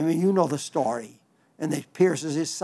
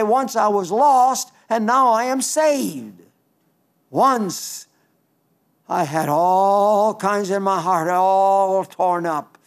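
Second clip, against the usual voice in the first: fervent testimony; 3 semitones higher, 5 dB louder, slower than usual. Acted. Testimony quoted in preaching.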